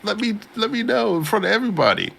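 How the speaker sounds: high-pitched clueless imitation; mock oblivious questioner. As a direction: blurt it out in a silly high voice. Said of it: "then you just like".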